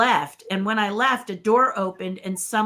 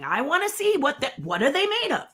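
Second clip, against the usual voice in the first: higher, eager questioning; lightly excited, pitched up; eager curiosity; 7 semitones higher than usual. Retold or imitated. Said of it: "And I'm going".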